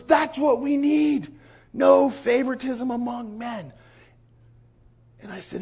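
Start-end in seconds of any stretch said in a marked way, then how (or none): 0.0-3.7 high, slow, emphatic declaration; imitated fervent exclamation. Sheikh responding to Peter's words, slow and fervent. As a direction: proclaim it as a great discovery.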